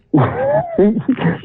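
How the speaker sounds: sudden high-pitched giggle; high laugh. Real, not acted. Catching himself mid-story, a high giggle. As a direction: burst into a squeaky laugh.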